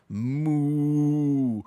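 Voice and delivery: loud cow moo, put on; a loud, silly cow noise; mock moo. Imitating a cow mid-story.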